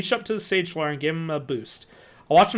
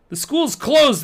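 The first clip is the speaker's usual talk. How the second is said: growl; 9 semitones higher, 7 dB louder, slower than usual. A narrator's reading. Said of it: "the janitor growled".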